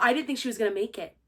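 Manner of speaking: ordinary talk, nothing marked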